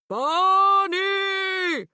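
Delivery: long, loud booming call. A narrator's reading. Dad calling the lost dog through the woods, booming. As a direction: boom it through the trees. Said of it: "in his booming voice".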